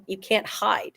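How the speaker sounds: ordinary talk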